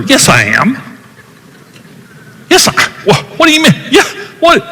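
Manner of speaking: high-pitched incredulous denial, mock defensive; pushing back defensively. Husband to wife, pushing back in mock high disbelief. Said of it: "And I pushed back at her".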